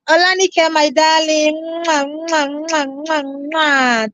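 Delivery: soft kisses blown to a fan; blowing kisses. Real, not acted. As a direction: kiss the viewer warmly through the mic. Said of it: "greeting a viewer with kisses".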